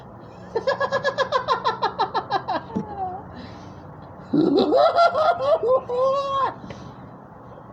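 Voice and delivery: high, long maniacal villain laugh; Joker laugh. Shown as a demonstration. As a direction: cackle like a mad clown villain. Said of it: "type of laugh".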